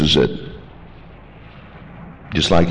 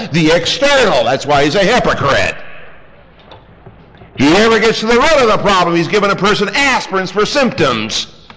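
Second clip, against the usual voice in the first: fiery preaching; 13 semitones higher than usual. Acted. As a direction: thunder it from the pulpit against the hypocrites. Preacher rising to full force.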